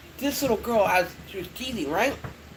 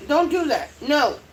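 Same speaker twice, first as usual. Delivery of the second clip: higher, sharp command to a dog; real scolding; 7 semitones higher, 3 dB louder than usual. Real, not acted. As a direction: tell the dog off firmly. Scolds the dog mid-video.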